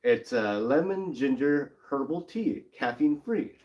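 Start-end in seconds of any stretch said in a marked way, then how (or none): none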